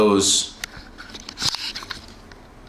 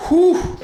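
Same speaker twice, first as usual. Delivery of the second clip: high, loud whew; real excited whew; 4 dB louder than usual. Real, not acted. Overexcited about food.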